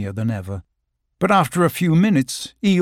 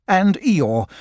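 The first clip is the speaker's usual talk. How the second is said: ordinary delivery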